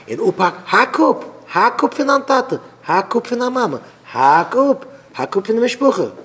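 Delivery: high, loud, punchy repeated commands; urgent egging-on. Acted. Bad adviser urging a cut from the family, high insistent calls. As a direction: egg them on with mounting insistence.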